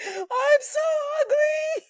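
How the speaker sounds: very high, loud whiny wail; hammed-up squeal of self-pity; mock wail. Retold wail to her friend.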